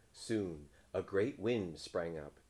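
plain reading voice

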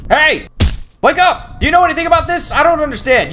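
high, pleading call; urgent calling out. Real, not acted. Gamer waking a character for help, urgent call. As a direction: rouse someone, pitched high and insistent.

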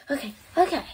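ordinary talk, nothing marked

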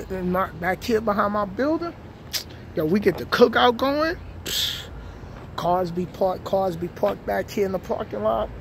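ordinary delivery

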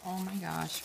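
ordinary talk

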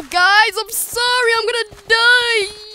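high-pitched doomed whine; panicked wail. Real, not acted. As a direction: wail as the game slips away. About to die on last level.

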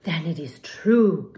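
slow, lightly voiced solemn decree; slow understated solemn decree; a hint of a solemn decree. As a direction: pronounce it slowly like a verdict.

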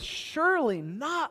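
surely not stretched slow and high, rising; drawn out. Retold or imitated. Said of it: "He says".

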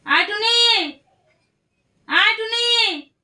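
loud, high, drawn-out call. Real, not acted. Calling Tuni to come, loud calling out. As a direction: call someone over from another room. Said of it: "আর টুনিকে ডাক দেই".

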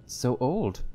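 ordinary talk, nothing marked

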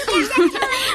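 a quick high laugh; amused laugh. Acted. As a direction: laugh off the teasing. Regina laughs at the teasing.

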